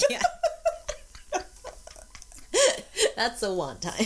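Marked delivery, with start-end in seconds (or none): 0.3-3.1 long airy giggle; high breathy laugh. Real, not acted. Laughing at her own story.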